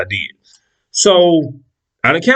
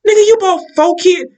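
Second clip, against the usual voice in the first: incredulous outburst; 11 semitones higher than usual. Retold or imitated. Outraged retort.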